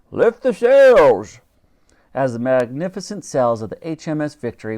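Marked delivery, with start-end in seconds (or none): 0.1-1.4 high, very slow, loud bellowed order; booming stretched ship's order; Admiral's order. A narrator's reading. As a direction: outdo the girl's command.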